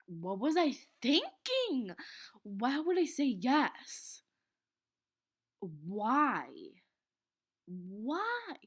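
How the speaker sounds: loud, slow, exasperated at herself; loud disbelief. Real, not acted. Berating herself for admitting the fart, loud disbelief. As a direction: shout it at your own past self. Scolding herself over saying yes.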